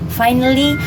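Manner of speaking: plain reading voice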